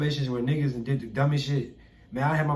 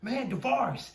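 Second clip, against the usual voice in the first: retold outraged complaint; 8 semitones higher than usual. Telling mentor about stolen shoes.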